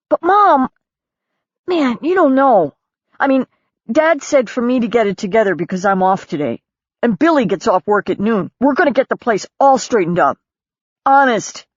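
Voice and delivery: a son begging his mom to stay; embarrassed son pleading, voice up high; pleading teenage son. A narrator's reading.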